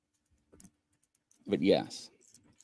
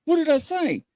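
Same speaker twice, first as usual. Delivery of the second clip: high, pleading reproach; incredulous scolding. Retold or imitated. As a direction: scold a friend for his slip. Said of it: "I'm like".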